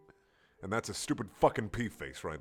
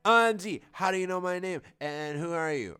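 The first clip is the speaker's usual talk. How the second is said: mock girly voice; 7 semitones higher, 4 dB louder than usual. Marissa's line read in character.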